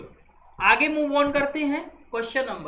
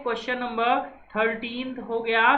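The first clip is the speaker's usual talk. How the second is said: normal talking voice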